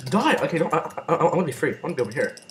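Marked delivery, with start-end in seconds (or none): 0.0-0.3 high loud yell; battle yell. Real, not acted. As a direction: bark the kill. Attacking in game.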